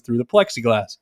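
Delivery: usual speaking voice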